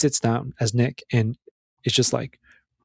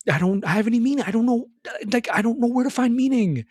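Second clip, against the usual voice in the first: desperate plea; 9 semitones higher than usual. Retold or imitated. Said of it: "Nick is saying".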